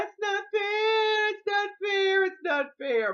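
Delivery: put-on loud, high, drawn-out childish protest; re-enacted high, sing-song yell. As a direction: wail it like a wronged kid, stretching each repeat. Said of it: "And I'm yelling".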